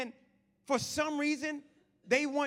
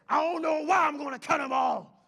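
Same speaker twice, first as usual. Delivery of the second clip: mock gangster-rap shout; loud, high angry yell, hammed up; 4 semitones higher, 9 dB louder than usual. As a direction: imitate a raging rapper at full volume. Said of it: "Everybody's yelling".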